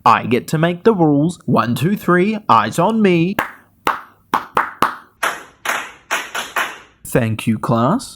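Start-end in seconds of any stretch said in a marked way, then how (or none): 1.4-8.1 kindergarten teacher voice. Pretend. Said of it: "because I'm the teacher".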